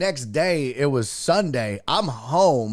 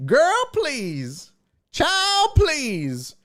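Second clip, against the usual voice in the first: sassy dismissal; 12 semitones higher, much slower than usual. Real, not acted. Dismissing doubt about getting paid.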